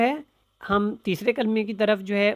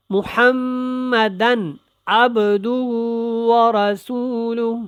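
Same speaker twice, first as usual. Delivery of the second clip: slow, drawn-out recitation with clear letters; careful tajweed recitation; 3 semitones higher, 3 dB louder, much slower than usual. Shown as a demonstration. Teacher demonstrating the kalima to learners, slow tajweed recitation. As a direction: recite slowly, stretching each word. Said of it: "اب با کے اوپر قلقلہ".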